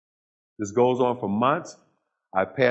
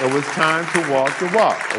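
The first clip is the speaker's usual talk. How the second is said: "walk the walk" driven home louder; louder emphatic repeat; 5 semitones higher, 5 dB louder than usual. Real, not acted. Speaker to audience, repeating his vow, louder and firmer. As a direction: say it again and punch it out to the room.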